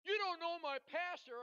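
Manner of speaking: high, loud incredulous question; going nuts. Retold or imitated. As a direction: imitate her astonishment at full volume. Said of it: "now she goes nuts".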